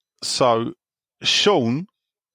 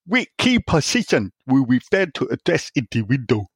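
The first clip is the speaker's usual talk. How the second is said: put-on reading in a light Chinese accent; 2 semitones higher than usual. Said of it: "Just speak normally".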